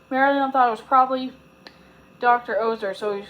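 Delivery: usual speaking voice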